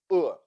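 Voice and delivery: short, isolated 'uh'; schwa sound. Shown as a demonstration. Teacher demonstrating a vowel to learners, schwa sound. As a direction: say a bare neutral 'uh'. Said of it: "sounds like schwa".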